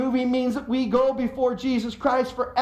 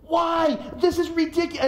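mock freak-out; 8 semitones higher than usual. Head buried in his wife, a higher panicky whine. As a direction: whine it into your wife's side. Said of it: "put my head into ... and go".

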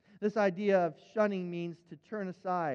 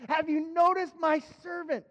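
God bragging; 8 semitones higher, 6 dB louder than usual. Acted. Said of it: "God goes into this brag session".

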